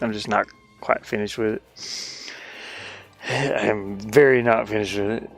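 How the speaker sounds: usual speaking voice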